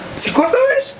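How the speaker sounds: high-pitched shocked question. Real, not acted. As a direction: squeal it in disbelief. Sees the served dish.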